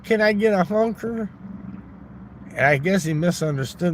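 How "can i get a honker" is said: mock prayer. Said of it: "I was saying".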